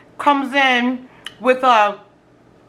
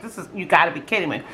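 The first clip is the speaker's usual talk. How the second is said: annoyed under breath; 8 semitones lower, 5 dB quieter, faster than usual. Retold or imitated. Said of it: "really mad at that time".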